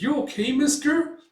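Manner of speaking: high, gentle check-in; concerned little voice. Retold or imitated. Companion checking on the fallen old man, high concerned voice. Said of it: "It's like".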